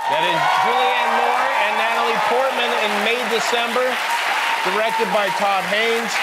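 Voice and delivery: announcer-style clip intro; formal TV host intro. Acted.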